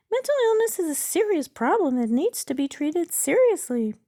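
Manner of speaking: high, loud bot character; chatbot voice. Acted. Chatbot answers user on treating mental illness, high bot voice.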